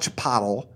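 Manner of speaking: "Chipotle" ending like bottle; mispronounced chi-POT-ul. Shown as a demonstration. Showing co-host another wrong way, "Chipotle" as chi-POT-ul. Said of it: "It's not Chipotle".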